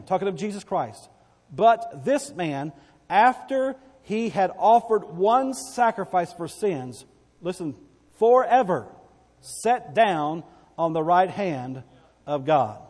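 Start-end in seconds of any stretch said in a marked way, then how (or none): 1.5-12.8 slow, loud scripture proclaimed; preacher reading the verse slowly and loud; slow emphatic reading. Acted. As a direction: lean on every word of the verse.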